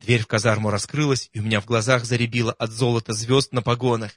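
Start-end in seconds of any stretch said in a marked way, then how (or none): none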